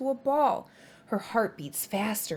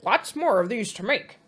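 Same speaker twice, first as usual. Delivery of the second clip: busy Beaver; 3 semitones higher, 5 dB louder than usual. Acted. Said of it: "mutters Beaver".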